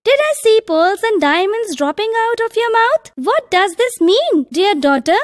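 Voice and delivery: high-pitched amazed questioning; astonished mother. A narrator's reading. Astonished mother questions her daughter.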